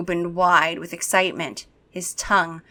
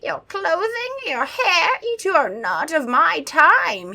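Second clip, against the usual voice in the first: high, slow vampire voice; lightly eerie; vampire inspects the kids' clothes; 9 semitones higher than usual. Acted.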